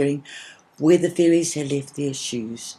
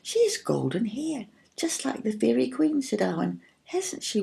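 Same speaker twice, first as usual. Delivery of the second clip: sister remarking; 7 semitones higher, 4 dB quieter than usual. A narrator's reading. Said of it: "Her sisters looked at her in astonishment".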